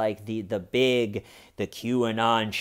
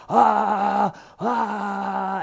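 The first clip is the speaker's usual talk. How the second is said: mock shaman yell; 7 semitones higher than usual. Imitating the shaman screaming in public, mock yell. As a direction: let out a hammed-up shaman yell. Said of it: "the guy that goes".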